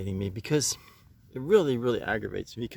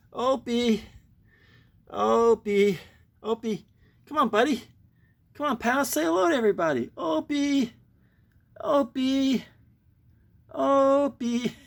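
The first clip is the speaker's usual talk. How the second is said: calling the pet; 12 semitones higher, 4 dB louder than usual. Real, not acted. Keeps calling shy pet.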